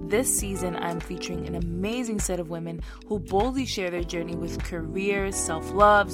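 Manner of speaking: slow, polished intro read; podcast intro. Acted. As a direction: announce the show over music. Podcast intro read over music.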